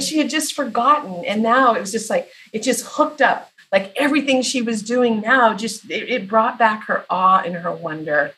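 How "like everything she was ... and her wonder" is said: normal talking voice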